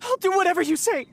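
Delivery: high, frantic pleading; panicked surrender. Acted. Caught thief surrenders to investigator, high and frantic. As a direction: give up in a hurry, terrified.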